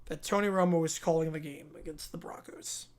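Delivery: soft unvoiced whisper; whispered aside. Real, not acted. Fan whispering about Romo calling the game, hushed. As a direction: lean in and whisper it.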